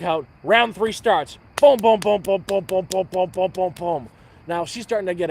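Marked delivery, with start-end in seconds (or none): 1.6-4.1 loud, punchy booms building in a run; rhythmic booms. Retold or imitated. Joke teller acting out round three, loud rhythmic booms. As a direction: hammer each boom like a drumbeat.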